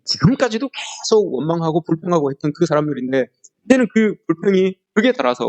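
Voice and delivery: ordinary delivery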